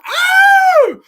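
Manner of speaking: very high, loud startled yelp; mock yelp. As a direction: shriek as if grabbed from above. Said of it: "He goes".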